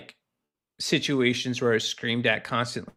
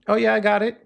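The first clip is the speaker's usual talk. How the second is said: breezy coworker; 9 semitones higher, 6 dB louder, faster than usual. Retold or imitated. Coworker casually agreeing in passing.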